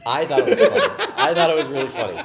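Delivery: laughing loud and high through the words. Real, not acted. Insisting to the hosts that his story was funny, laughing loudly. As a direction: burst out laughing as you insist.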